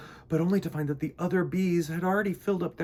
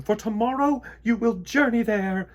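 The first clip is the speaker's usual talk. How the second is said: queen declaring; 8 semitones higher, 7 dB louder than usual. A narrator's reading. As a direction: lay down the order to the bees. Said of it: "declared the queen".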